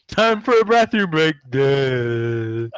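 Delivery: high, loud dopey voice falling to a dull "duh"; hammed-up mock imbecile announcement; slack-jawed drooler. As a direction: play him vacant and drooling. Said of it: "goes slack-jawed and starts drooling".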